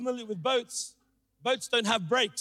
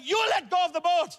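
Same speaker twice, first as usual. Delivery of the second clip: high mock-angry shout; acted indignant retort; imitated yelling dad; 9 semitones higher, 4 dB louder than usual. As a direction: yell back like a stubborn dad, voice high. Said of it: "he's yelling back at me".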